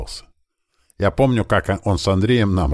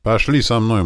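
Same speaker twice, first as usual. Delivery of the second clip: higher, friendly-firm beckoning call; calling them along; 7 semitones higher, 4 dB louder than usual. A narrator's reading. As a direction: call the group to follow. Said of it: "Позвал их за собой боец". (позвать за собой)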